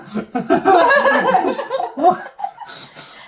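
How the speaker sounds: high, long fit of laughter; helpless laugh. Real, not acted. Cracking up at her own rambling, high and long. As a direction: laugh till it runs out.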